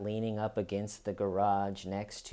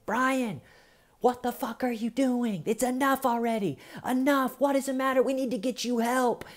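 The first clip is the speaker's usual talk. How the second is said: high, exasperated mother finally losing it; a mother's patience snapping, pitched high; losing it with son; 13 semitones higher than usual. Retold or imitated. Mother snapping at her son at night, high and exasperated. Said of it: "she lost it on him and said".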